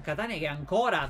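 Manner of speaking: ordinary talk, nothing marked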